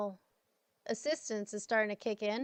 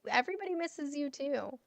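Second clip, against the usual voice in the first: ordinary delivery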